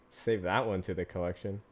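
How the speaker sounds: usual speaking voice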